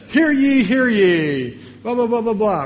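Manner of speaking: loud, high proclaiming call; town crier. Retold or imitated. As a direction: bellow it like a herald in the square. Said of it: "you know what a town crier say?".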